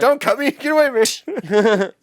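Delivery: high, loud panicked plea, slowing at the end; whiny villain begging off, hammed up; mock Witch King. Voicing the Witch King for a friend, high panicked plea. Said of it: "the Witch King just goes".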